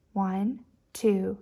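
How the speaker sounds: usual speaking voice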